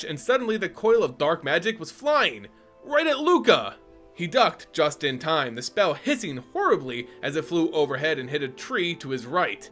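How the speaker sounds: ordinary delivery, nothing marked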